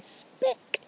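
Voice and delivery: SPECK drawn out and popped, with a crisp k; silly stressed speck. Shown as a demonstration. Stargazer showing how you have to say speck, silly drawn-out voice. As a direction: lean comically on the word SPECK. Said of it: "in that tone of voice".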